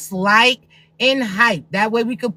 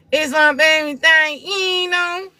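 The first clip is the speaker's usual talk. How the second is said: slow, higher mock of her ex; mocking baby dad; 5 semitones higher, slower than usual. Mocking her baby dad's excuse in a slow high voice. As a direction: drawl it out like a lazy ex excusing himself. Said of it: "Anytime he told you".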